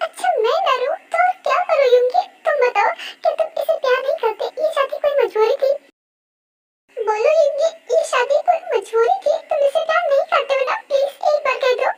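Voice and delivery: high, whining, tearful plea; tearful pleading. Acted. Rose begs Yoongi to call his marriage forced, crying. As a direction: beg through acted tears for the answer you want. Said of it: "पर please तुम क्यों रो रही हो".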